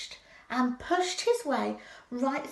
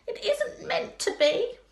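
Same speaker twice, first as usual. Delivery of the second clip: high put-on voice for Rabbit; disguised Rabbit; 10 semitones higher, 4 dB louder than usual. A narrator's reading. Rabbit hiding his identity from Pooh, disguised voice. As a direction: pitch it well up, as if someone else. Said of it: "in a different sort of voice".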